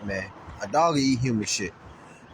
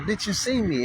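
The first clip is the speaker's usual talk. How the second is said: pissed off; 6 semitones higher than usual. Retold or imitated. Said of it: "So I was just pissed off".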